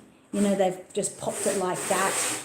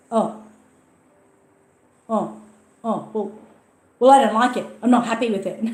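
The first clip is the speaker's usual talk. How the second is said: a retold customer's flustered oh's, then a louder sulky complaint; huffy customer; 6 dB louder than usual. Buyer caught not reading the listing, answering the seller: flustered, then loudly sulky. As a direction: play the caught-out buyer getting defensive.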